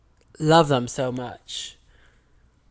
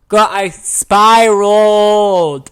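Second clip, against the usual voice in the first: drawn-out, much louder and higher cry; dramatic wail; 6 semitones higher, 10 dB louder, much slower than usual. Real, not acted. Telling viewers how she broke down, slow loud wail. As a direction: stretch it out like a wail.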